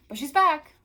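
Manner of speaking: high, loud singsong cheer; playful announcement. Real, not acted. Reader announcing Melanie's return, high loud cheer. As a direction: announce it with a triumphant lift.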